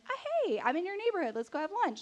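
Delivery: high, chirpy imagined invitation; mock-casual, high-pitched hey; breezy phone greeting. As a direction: pitch up into a casual "just dropping by" hello. Imagined casual call to him.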